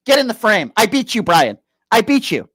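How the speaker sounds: taunting, a hint of a gloating rival; mock gloating at Brian, cigarette in mouth; mocking Andrew Wilson. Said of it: "This is Andrew Wilson".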